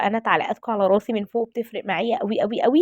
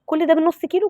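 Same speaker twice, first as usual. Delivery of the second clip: pitched high, "ده" lifted on a rising tune; excited; 6 semitones higher than usual. Real, not acted. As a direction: sound amazed that "كل ده" comes from half a kilo. Amazed at half a kilo. (بدهشة وحماس بصوت عالي النبرة)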